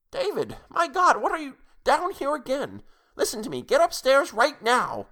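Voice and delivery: high-pitched, urgent grandmotherly scolding; alarmed scolding. A narrator's reading. Grandmother catching the child in the basement, alarmed scolding.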